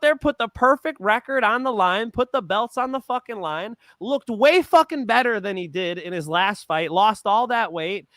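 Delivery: heated, high-pitched defense; emotional, worked up. Real, not acted. Fan defending Fury to his co-host, emotional and worked up. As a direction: argue it passionately, pitch riding high. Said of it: "I'm emotional about it".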